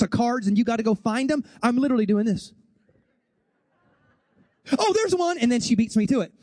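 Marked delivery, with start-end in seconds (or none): 4.8-5.4 high-pitched mock exclamation of discovery; excited spotting. Wife spotting a card in the game, mock excited. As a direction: call out the find in a raised pitch.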